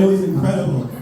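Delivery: ordinary delivery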